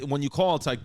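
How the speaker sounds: ordinary talk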